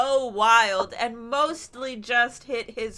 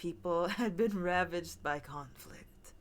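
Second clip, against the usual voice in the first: lower, softer storyteller read; story narration; 7 semitones lower, 6 dB quieter than usual. Acted. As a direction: read it like a campfire tale. Reads a spooky folklore segment.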